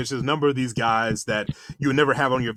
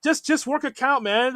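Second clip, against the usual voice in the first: imitated fan yell; 10 semitones higher than usual. Fan yelling at Taylor from the stands, in a high pleading shout. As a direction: holler at the batter from your seat. Retelling his own booing.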